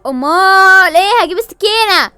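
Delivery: loud, high comic threat; mock furious yell. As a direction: yell it like you're grabbing a knife. (زعيق تهديد ساخر)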